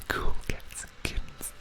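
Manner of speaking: hushed mock whisper of the catchphrase. Quiz host teasing viewers with a whispered catchphrase. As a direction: breathe it out in a whisper.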